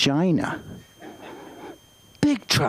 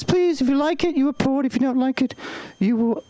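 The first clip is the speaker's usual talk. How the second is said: Queen of England; 5 semitones higher than usual. Acted. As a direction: do a stately old queen, higher pitched. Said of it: "Here you go, the Queen".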